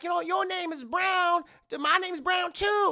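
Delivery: high, loud delighted character voice; excited Mister Brown. Retold or imitated. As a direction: squeal it up high, thrilled by the coincidence.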